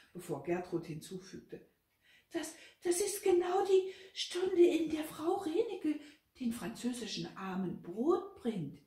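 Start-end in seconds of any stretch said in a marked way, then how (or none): 2.3-8.8 high, loud, stammered outburst; agitated high voice, easing toward the end; alarmed realization. A narrator's reading. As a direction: blurt it out in shocked alarm. Shocked Gertrud adds a stammered realization.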